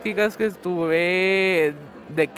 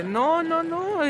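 sharply higher, frantic denial; high-pitched panic, refusing what happened; panicked no; 11 semitones higher, much slower than usual. Retold or imitated. (negación asustada, mucho más aguda)

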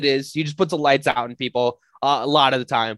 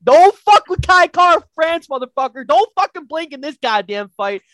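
much higher and louder, yelling with laughter; hyped shouting; 8 dB louder, slower than usual. Real, not acted. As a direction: scream it like you're cageside cheering. Hyped fan cheers on his fighter.

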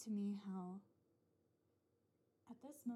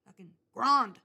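loud blunt grunt of a word; mock caveman grunt; 16 dB louder than usual. Someone coining a word with a loud grunt. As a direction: bark it like a caveman naming things. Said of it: "someone just looked at someone and was like".

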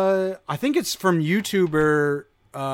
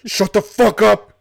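higher and much louder yell; loud mock yell; 7 semitones higher, 9 dB louder than usual. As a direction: bark it like a robber. Mock robber yelling louder.

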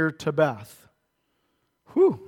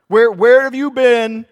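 indignant outburst; 5 semitones higher, 11 dB louder than usual. Acted. Gideon challenges God.

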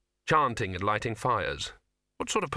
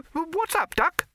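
very high cartoon rabbit voice; rabbit character; 5 dB louder, slower than usual. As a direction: squeal it as a cheeky rabbit. Rabbit character greets the duck.

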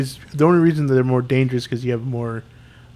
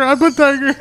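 high mock Indian accent, much higher; imitated Indian accent; 12 semitones higher than usual. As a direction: parrot the tiger voice, pitched up. Quoting the tiger line, higher.

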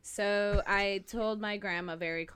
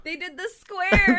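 much higher and louder, laughing it out; real laughter; 12 semitones higher, 16 dB louder than usual. Real, not acted. Laughing retelling.

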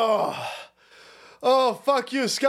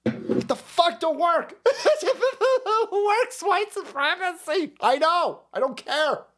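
higher, slow put-on whiny voice; mock coworker; 9 semitones higher than usual. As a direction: sneer it like the fragile coworker. Mocking lefty coworker continued.

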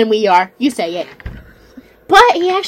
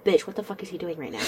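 hushed; 9 semitones lower, 14 dB quieter, faster than usual. Retold or imitated. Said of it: "And I'm like".

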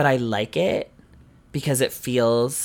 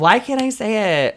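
exasperated; 10 semitones higher, 5 dB louder than usual. Real, not acted. Frustrated at failing the meme.

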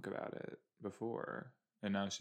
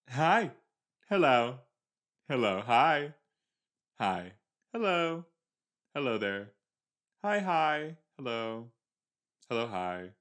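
louder and higher, calling hello to each passerby; loud playful greetings; 4 semitones higher, 8 dB louder than usual. Pretend. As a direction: wave and call out cheerfully to everyone. Greeting town characters on screen.

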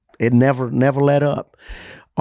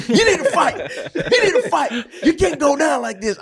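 much higher, yelled retelling of the doctor; retold yell. ER doctor yelling at the sick patient to fight. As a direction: shout it like the doctor did, urging him on. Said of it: "He started yelling at me".

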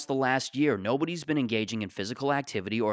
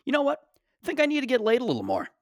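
high excited confession; 13 semitones higher, 3 dB louder, faster than usual. Acted. Comic high-pitched confession.

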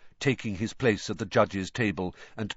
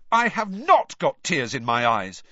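firm, louder protest; 6 semitones higher, 6 dB louder than usual. A narrator's reading. Harry loudly denying tears to the reporter. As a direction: push back hard. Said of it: "said Harry loudly".

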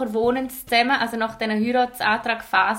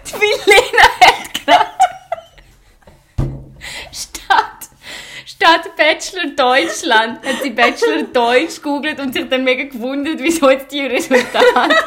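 louder, higher, breaking into laughter; laughing while talking; 3 semitones higher, 8 dB louder than usual. Real, not acted. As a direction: talk through a laughing fit. Telling joke while laughing.

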